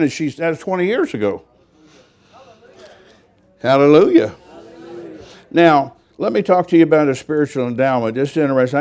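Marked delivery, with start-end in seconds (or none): 3.6-4.3 loud praise. Real, not acted. Praise exclamation in sermon. 4.5-5.2 whispered praise. Real, not acted. Praise repeated under breath.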